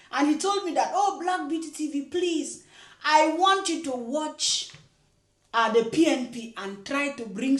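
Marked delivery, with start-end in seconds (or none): none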